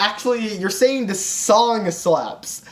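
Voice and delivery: ordinary talk, nothing marked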